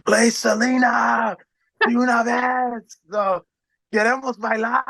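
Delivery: high, drawn-out heckler's yell; imitated screaming fan. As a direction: yell a request like a woman in the crowd. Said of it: "there was this lady screaming".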